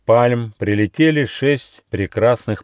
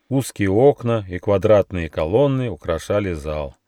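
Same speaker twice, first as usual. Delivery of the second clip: usual speaking voice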